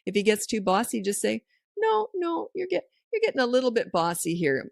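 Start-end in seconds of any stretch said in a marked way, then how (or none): 1.8-4.7 gentle high-pitched correction. Shown as a demonstration. Parent checking a bossy child at play, high and light.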